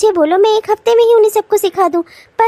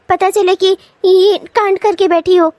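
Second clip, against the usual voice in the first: ordinary talk, nothing marked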